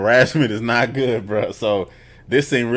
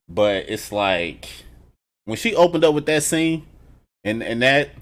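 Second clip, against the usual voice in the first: normal talking voice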